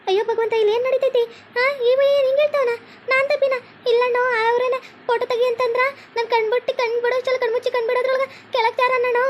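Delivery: high-pitched crying wail over her fallen husband; wailing lament. Acted. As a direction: wail in disbelief, voice high and breaking. Wife cries explaining to bystanders.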